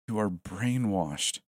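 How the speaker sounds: slow and deliberate; slowly. Acted. Smith telling Elle she is brainwashed, slowly. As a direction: spell it out word by word. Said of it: "I said slowly".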